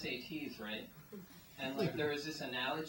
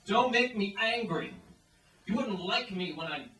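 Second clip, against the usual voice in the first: Hulk warning; 5 semitones higher, 7 dB louder than usual. Retold or imitated. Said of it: "If he was like".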